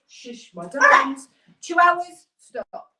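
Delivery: loud, high shush to the dogs; calm the pets down firmly; real loud hushing of her dogs. Real, not acted.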